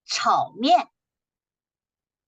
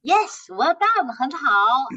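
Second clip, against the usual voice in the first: praise; 4 semitones higher, 4 dB louder, slower than usual. Real, not acted. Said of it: "praising a student's answer".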